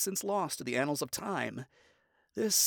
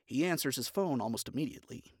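plain reading voice

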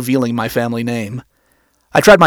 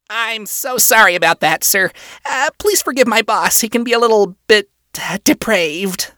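high, stammering, apologetic employee; scratchy high-pitched imp; 7 semitones higher than usual. A narrator's reading. Male employee apologizing for his boss, scratchy and high-pitched. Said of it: "voice scratchy and high-pitched".

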